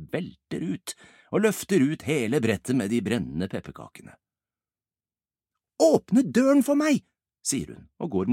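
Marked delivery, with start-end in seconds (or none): 5.8-7.0 high, loud, drawn-out order; urgent command. Acted. Ibbi carries burning cookies to the garden, calling urgently. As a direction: call it out urgently, pitched high.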